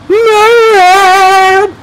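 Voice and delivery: very high, loud drawn-out squeal; over-the-top high-pitched yell; hammed-up Moab cry. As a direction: squeal it out long in falsetto. Mock celebratory cry of streak name.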